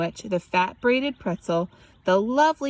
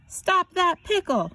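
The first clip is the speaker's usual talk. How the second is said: higher, slow call after the pickle; shout; 9 semitones higher than usual. A narrator's reading. Mister Adolph calls out as he gives chase. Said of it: "shouted Mister Adolph".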